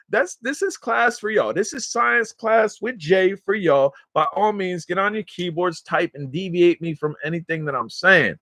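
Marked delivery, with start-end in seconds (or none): none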